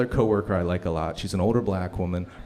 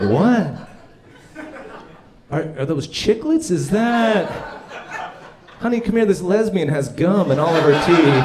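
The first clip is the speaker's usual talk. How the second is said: gawking strangers; 7 semitones higher, 5 dB louder than usual. Retold or imitated. Strangers staring at braces.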